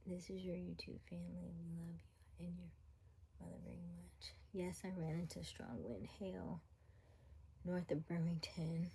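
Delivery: soft whisper throughout. Real, not acted. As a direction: keep it to a hush. Whispered livestream chat.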